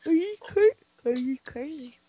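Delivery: talking through a yawn; words stretched by a yawn. Real, not acted. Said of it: "I was, like, yawn talk".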